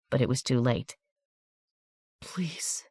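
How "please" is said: a faint, softer murmur; wounded soldier begging quietly; murmured please. A narrator's reading. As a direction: plead with almost no breath left. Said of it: "murmured the soldier".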